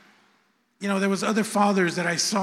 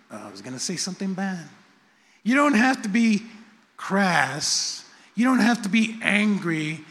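ordinary talk

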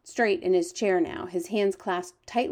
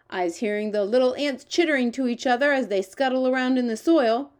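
higher-pitched storybook giant with quaint grammar; lively, raised giant character voice; eager, boastful giant; 4 semitones higher, 5 dB louder than usual. Acted. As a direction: play the friendly giant, proud of his hearing. The giant's lines in a read story.

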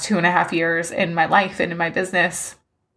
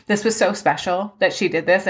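ordinary delivery

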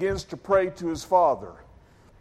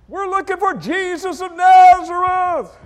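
yelling out; 10 dB louder than usual. Retold or imitated. One of the crowd answering Jesus, yelling out. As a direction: holler back from the crowd. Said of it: "one of them yells out".

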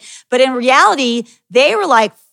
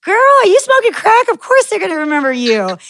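mock disbelief; 8 semitones higher than usual. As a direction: jump high, then settle as you reassure. Teasing disbelief in retold reply.

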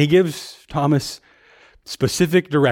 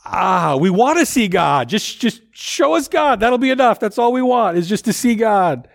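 higher, earnest begging; pleading Philip; 6 semitones higher than usual. Acted. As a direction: ask like a disciple who just wants to see. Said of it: "this time it's Philip's turn to ask the question".

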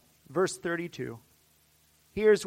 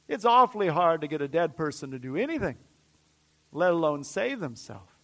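ordinary talk, nothing marked

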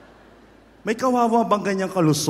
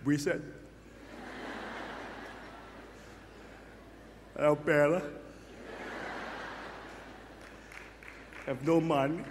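softer, sulky grumble; grumpy mopey waking up; 3 semitones lower, 12 dB quieter, faster than usual. Pretend. Preacher mimics a gloomy person waking up, softer and sulky. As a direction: sulk about being broke. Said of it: "are you like this?".

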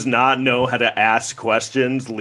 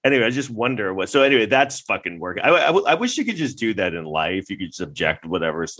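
ordinary talk

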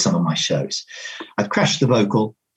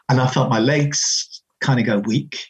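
ordinary talk, nothing marked

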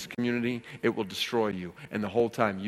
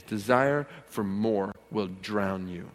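normal talking voice

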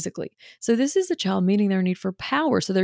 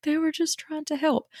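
guilty; 8 semitones higher than usual. Retold or imitated. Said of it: "we feel guilty after".

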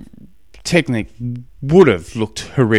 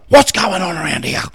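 raised, higher-pitched mock yell; acted exasperated dad, voice pitched up; storming-parent yell; 7 semitones higher than usual. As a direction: call it out like a dad storming upstairs. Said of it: "storm upstairs".

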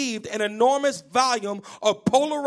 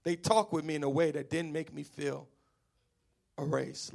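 quiet repeat; 6 semitones lower, 7 dB quieter than usual. Shown as a demonstration. Preacher repeating the quote so it sinks in, hushed. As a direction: let it land, hushed. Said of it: "Let that sink in".

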